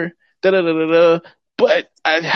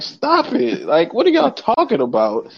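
dismissive; 7 semitones higher than usual. Real, not acted. Telling small-stakes bettors to calm down, dismissive. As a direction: brush them off, amused.

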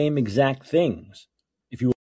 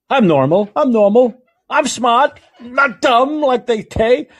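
high, loud mocking impression; Donald Trump; 12 semitones higher, 9 dB louder than usual. Host mocks a politician's odd speech, high loud impression. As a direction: shout it as an over-the-top caricature. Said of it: "the way he talks is so weird".

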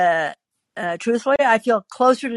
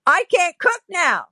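much higher-pitched huffy protest; exasperated complaint; 10 semitones higher than usual. Acted. Husband sneezed on while cooking, exasperated.

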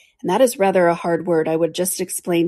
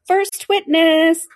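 high, slow announcing call; 11 semitones higher, 4 dB louder, much slower than usual. Acted. The rabbit announcing the witness to court, slow high call. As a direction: draw the words out like a herald. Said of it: "He called out".

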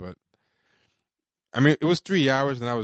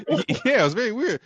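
laughing; 12 semitones higher, 4 dB louder, faster than usual. Real, not acted. Laughing agreement.

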